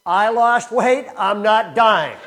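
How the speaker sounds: mock-solemn public announcement; loud announcement. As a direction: declare it loudly to the congregation. Pastor announces to church.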